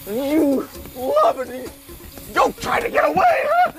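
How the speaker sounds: loopy rising sing-song, lurching between words; giddy, delirious declarations. Acted. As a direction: ramble like a man high on fumes. Dad giddy from the fumes.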